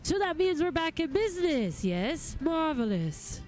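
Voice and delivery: courtly gentleman, pleased, opening high; formal gentleman goldsmith. Acted. Reads a game figure's dialogue.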